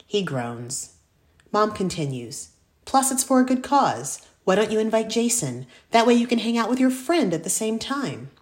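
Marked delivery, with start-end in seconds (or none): 2.8-8.3 coaxing mom. Acted. Mom coaxing her son.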